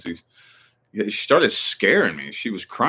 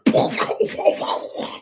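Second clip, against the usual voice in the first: blurted wordless puffing noise; imitated recoil from stench. Anyone sniffing a shot of acid water, exaggerated recoiling noise. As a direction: mimic being knocked back by a strong smell. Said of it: "you smell this shit, you'll be like".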